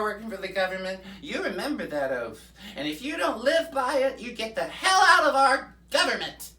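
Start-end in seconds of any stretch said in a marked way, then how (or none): 2.8-6.5 worked-up shout. Acted. Rallying rant against politicians.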